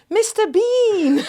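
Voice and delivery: high, slow, laughing imitation of an amazed clerk; awestruck woman. Imitating the clerk telling her who her dad is, awestruck and slow.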